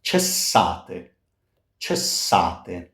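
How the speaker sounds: "Cessate" with ch, ches-SA-te; Italian pronunciation demo. Shown as a demonstration. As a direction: say "Cessate" slowly and clearly with ch. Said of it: "let's move on to pronunciation".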